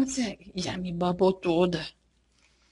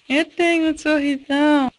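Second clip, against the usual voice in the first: voicing the baby; 5 semitones higher, 7 dB louder than usual. Mom voicing the baby's big smile, bright and loud. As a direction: lively voice for the baby's thoughts.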